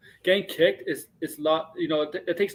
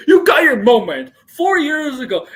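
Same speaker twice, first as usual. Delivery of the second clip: mock shout; 8 semitones higher, 8 dB louder than usual. Mocking wrestler on TV.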